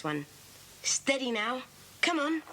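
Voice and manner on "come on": ordinary delivery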